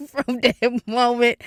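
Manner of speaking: laughing through the words; slow, high, giggly delivery; real laughter. Real, not acted. Podcaster recalling crying at the DMV, laughing. Laughs while telling of her breakdown.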